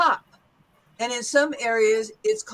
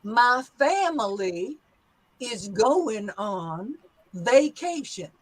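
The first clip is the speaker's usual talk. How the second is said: slow Southern drawl with long vowels; Southern accent, "going" without its g; 2 semitones lower, slower than usual. Shown as a demonstration. Teacher models new sentence, slow Southern accent. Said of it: "great Southern accent developing there".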